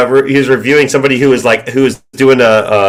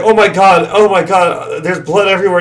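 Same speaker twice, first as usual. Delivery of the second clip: higher, hammed-up distress; mock panic; 6 semitones higher than usual. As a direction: play the crash victim, over the top. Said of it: "there's like".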